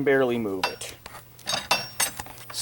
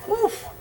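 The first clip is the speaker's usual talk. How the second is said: strained grunt. Real, not acted. Lifting heavy gate.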